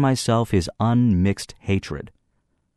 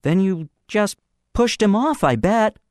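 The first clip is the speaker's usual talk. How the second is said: put-on higher hesitant boy; younger boy's guess; 13 semitones higher than usual. A narrator's reading. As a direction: guess it timidly.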